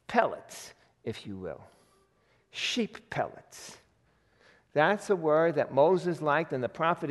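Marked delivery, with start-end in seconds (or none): 0.1-3.8 disgusted aside. Real, not acted.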